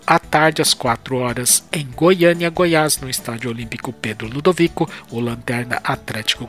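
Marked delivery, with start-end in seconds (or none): none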